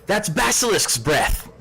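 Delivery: high urgent warning cry; calling out. Acted. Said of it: "as Kara calls out".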